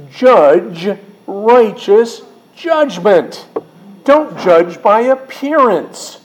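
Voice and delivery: high, very slow, emphatic scripture quote; preacher's proclamation. Retold or imitated. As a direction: declaim it word by word with ringing force. Preacher declaiming Jesus's command.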